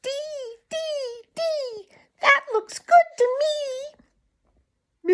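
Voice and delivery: very high little bird voice, eager; a tiny bird spotting food; chickadee voice. Acted. Tommy Tit the chickadee speaks.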